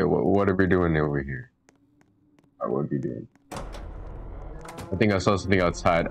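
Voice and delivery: usual speaking voice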